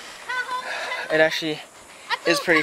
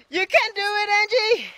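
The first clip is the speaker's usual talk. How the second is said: high self-encouragement; 13 semitones higher than usual. Real, not acted. Encouraging herself mid-crossing.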